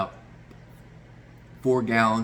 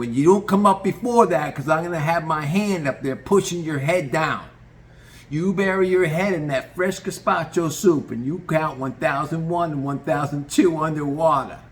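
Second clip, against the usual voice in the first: loud, much higher insisting; forceful boss; 6 semitones higher, 6 dB louder than usual. Retold or imitated. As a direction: lay down the rules hard. Tony insisting.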